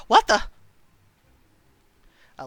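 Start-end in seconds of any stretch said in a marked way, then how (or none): 0.1-0.4 startled. Acted. Hears scraping in alley.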